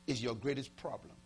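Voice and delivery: ordinary talk, nothing marked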